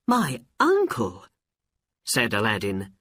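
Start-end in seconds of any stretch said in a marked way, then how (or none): 0.1-1.3 put-on high-pitched astonished boy; very surprised. A narrator's reading. Aladdin learning the old man is his uncle, surprised. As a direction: question it in high boyish disbelief. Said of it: "He was very surprised".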